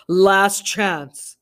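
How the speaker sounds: loud, deep warning; called through door. Acted. Intruder calling a last warning through the door. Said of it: "a deep voice called".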